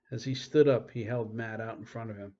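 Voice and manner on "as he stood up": ordinary delivery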